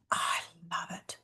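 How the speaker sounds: breathy whispered aside. Real, not acted. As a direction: whisper it like a little secret. Aside about favorite tool.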